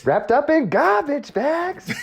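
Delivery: drawn-out, very high movie yell; Al Pacino. Acted. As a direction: stretch it out in a hammed-up actor impression. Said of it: "Again, insomnia".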